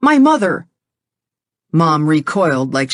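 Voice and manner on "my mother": high, sudden; blurted slip. Acted. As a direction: let it slip out.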